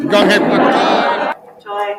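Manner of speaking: Gong Hei Fat Choi drawn out very slow and loud; mockingly repeating the clip's greeting. Mocks the greeting with clip.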